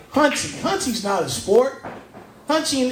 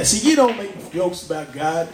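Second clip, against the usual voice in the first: ordinary talk, nothing marked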